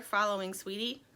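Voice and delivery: plain reading voice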